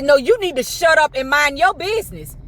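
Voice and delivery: high, indignant comeback; telling him off. Retold or imitated. Patient tells the man at the desk to mind his business, high and indignant. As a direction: snap back at the meddler. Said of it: "about to light everybody up".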